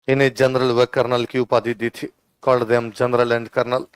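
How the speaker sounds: steady classroom dictation voice. Shown as a demonstration. Teacher dictating notes.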